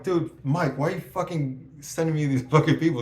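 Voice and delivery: high, loud complaint; retold exasperation. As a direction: whine at the boss. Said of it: "I'm like".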